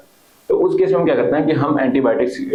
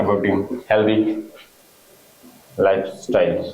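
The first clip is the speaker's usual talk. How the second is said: very slow, low dictation, long gaps between phrases; dictating; 3 semitones lower, much slower than usual. Real, not acted. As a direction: dictate word by word for students writing it down. Teacher dictating a point for notes. (रुक-रुक कर, धीमे लिखवाते हुए)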